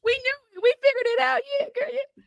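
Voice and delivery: very high, squealed reply; mock triumph. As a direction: act out knowing it all along in a squeaky voice. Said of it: "we was like".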